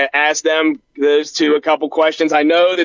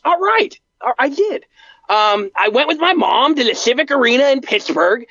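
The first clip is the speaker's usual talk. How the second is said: higher, eager recollection; Bart voice; 5 semitones higher than usual. Acted. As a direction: remember your first show with glee. Bart recalls first show.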